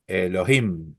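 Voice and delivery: Elohim with the stress landing on final "him"; accent on the last syllable. Shown as a demonstration. Teacher repeating Hebrew word for students, final-stressed Elohim. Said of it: "tenemos el acento".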